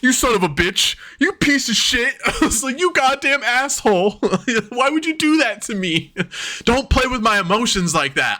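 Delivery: high-pitched, laughing mock outrage; mock outraged. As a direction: imitate a hurt, indignant outburst. Retold hurt outburst at wrestler.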